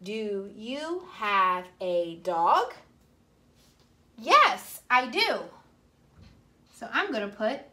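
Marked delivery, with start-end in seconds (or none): 0.0-2.7 slow reading. Shown as a demonstration. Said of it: "Now, I can answer it". 4.2-5.4 bright answer. Shown as a demonstration. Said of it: "Now, I can answer it".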